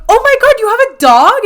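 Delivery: high, loud, thrilled disbelief; excited squeal. Retold or imitated. To the stoic musician about his dog, excited squeal. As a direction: squeal it like the best news ever.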